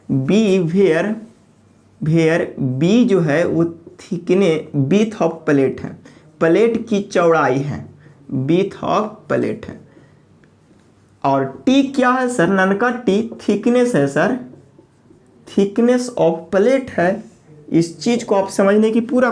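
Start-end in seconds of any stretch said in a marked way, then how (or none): 0.1-9.8 very slow, word by word; slow dictation. Shown as a demonstration. Teacher dictating a definition to the class, very slow. 15.5-17.2 very slow, word by word; slow dictation. Shown as a demonstration. Teacher dictating a definition to the class, very slow.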